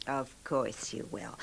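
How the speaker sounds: soft whisper, slowly; soothing whisper. Acted. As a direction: whisper it to calm him. Wife soothing sick husband.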